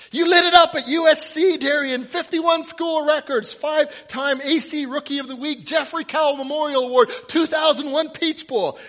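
high, loud stream of shouted facts; yelling. Acted. Fan reciting the quarterback's school record, yelling. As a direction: shout the list like a frantic fan.

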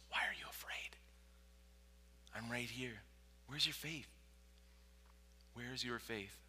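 whispered question, then soft reassurance; hushed Jesus. Acted. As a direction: answer the cry very quietly. Jesus answering softly beside you.

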